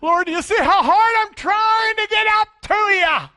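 high, loud, strained plea; hammed-up straining believer shouting to God; mock striving prayer. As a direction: whine up at God like a try-hard. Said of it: "climb the ladder and try harder".

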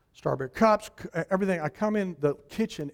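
usual speaking voice

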